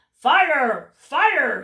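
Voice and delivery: loud alarm call; crying fire. Acted. Cat shouts a fake alarm at the birds, loud. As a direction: shout the warning to scare them. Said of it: "he cried".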